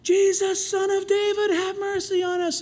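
high pleading shout for mercy; yelling plea. Retold or imitated. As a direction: cry out to be heard as he passes. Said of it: "they're yelling and crying and screaming".

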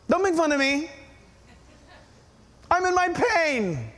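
mildly hurt, defensive complaint; acted emotional. Pastor imitates an emotional weeping woman, mildly wounded. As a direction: play wounded, drawing out the last plea. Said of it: "Have you ever been emotional?".

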